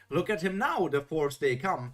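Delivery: ordinary delivery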